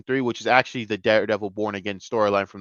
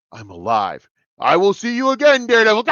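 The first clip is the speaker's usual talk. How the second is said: mock villain taunt; 8 semitones higher, 7 dB louder than usual. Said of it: "he's like".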